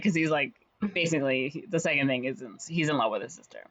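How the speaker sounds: normal talking voice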